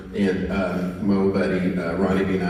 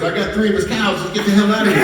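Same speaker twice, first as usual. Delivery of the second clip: excited getaway call; 10 semitones higher, 3 dB louder, faster than usual. Retold or imitated. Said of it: "T W goes".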